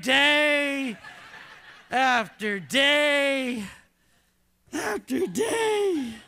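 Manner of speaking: drawn out with a laugh; slow repeat. Shown as a demonstration. Preacher repeating for married listeners, slow and amused. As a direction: stretch it again, amused. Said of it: "repeat, slower, laughing".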